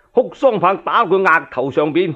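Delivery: plain reading voice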